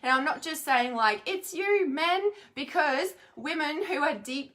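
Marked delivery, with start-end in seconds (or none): 1.2-2.3 mock accusation. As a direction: point the finger, mock-blaming. Voicing blaming women, quoted.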